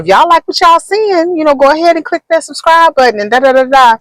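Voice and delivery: high-pitched mimicked subscribe plug; imitated content creator. Retelling another creator's plea for subscribers, high imitated voice. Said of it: "another content creator's video and".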